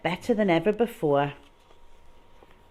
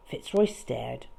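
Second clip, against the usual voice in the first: usual speaking voice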